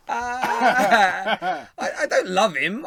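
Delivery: loud laugh into the denial; cracking up while saying I don't love him. Real, not acted. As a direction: laugh hard, then deny it. Laughing at Mourinho comment.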